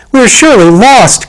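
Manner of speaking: high, loud wail of despair; despairing cry. Acted. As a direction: cry out that all is lost. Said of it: "cried Ginger in despair".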